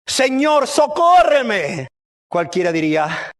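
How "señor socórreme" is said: raised-pitch plea with a drawn-out "socórreme"; acted urgent appeal for help; pleading cry. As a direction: cry out for rescue at his feet. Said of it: "se postra ante Jesús diciéndole".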